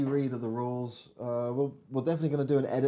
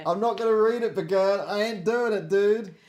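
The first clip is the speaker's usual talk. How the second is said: laughing refusal; 7 semitones higher, 6 dB louder than usual. Real, not acted.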